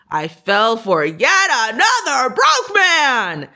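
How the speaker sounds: hammed-up wail of self-reproach; mock wail. Woman realizes she fell for a broke man, hammed-up lament.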